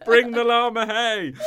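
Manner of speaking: slow, high, laughing llama demand. Pretend. Repeated animal voice.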